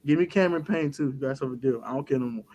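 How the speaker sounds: ordinary talk, nothing marked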